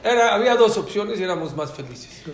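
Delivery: loud, laughing, slowing on the start; laughing nostalgia. Real, not acted. Rabbi to audience, loud laughing nostalgia. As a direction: laugh at how simple it was.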